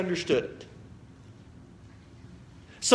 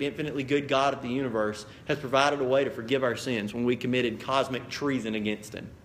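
usual speaking voice

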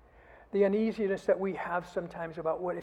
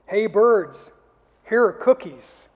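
much louder, higher call out to the birds; loud shout; 5 semitones higher, 17 dB louder, slower than usual. Acted. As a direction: shout it across the yard. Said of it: "he shouted in a loud ver-, voice".

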